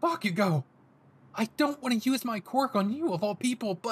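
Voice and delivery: put-on alarmed, earnest young hero; pleading Deku. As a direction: beg your friend to stop.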